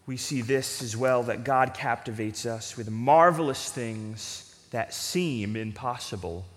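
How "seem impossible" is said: seem drawn out low and slow; stress on seem. Shown as a demonstration. Preacher to congregation, slowly leaning on seem. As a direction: lean on the word seem, slowly. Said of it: "Emphasis on that word seem".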